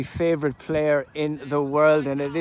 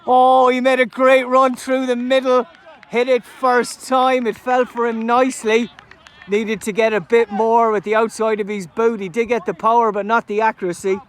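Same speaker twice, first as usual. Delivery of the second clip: loud, high, breathless near-miss call; excited commentary; 7 semitones higher, 7 dB louder than usual. Real, not acted. Commentator describing the near miss, still loud and excited. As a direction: ride the excitement of the chance.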